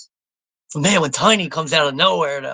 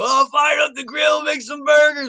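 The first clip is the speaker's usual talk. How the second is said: much higher-pitched eager voice; mock carefree enthusiasm; 11 semitones higher than usual. Imagined guy firing up the grill, mock-eager. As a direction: act out a keen griller, pitched way up. Imagines man starting the barbecue.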